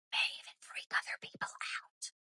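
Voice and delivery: hushed, voiceless whisper; whispered aside. Real, not acted. Confiding aside about freaking people out.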